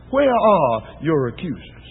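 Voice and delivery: soft and slow, full of mercy; Jesus gently asks the harlot, merciful and soft; tender, merciful tone. Acted. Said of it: "heard a different tone now".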